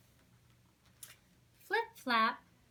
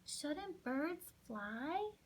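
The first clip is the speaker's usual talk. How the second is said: doubtful slow; 4 semitones higher, 4 dB quieter, much slower than usual. Acted. Pip doubtful.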